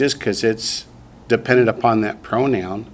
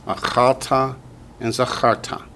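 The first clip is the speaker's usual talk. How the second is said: ordinary talk, nothing marked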